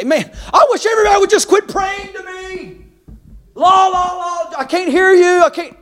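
high-pitched, hammed-up whining yell; mock exasperated whine. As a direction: play a fed-up God drowning out prayers. God mockingly imagined refusing prayers.

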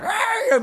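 high-pitched squawky rooster noise; imitated rooster crow. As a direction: mimic a rooster going off at midnight. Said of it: "making that round".

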